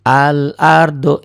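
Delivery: al-ardu with the l clearly sounded, slow and loud; careful recitation. Shown as a demonstration. Teacher showing pupils a lam that is read. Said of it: "maka lamnya dibaca al-ardu".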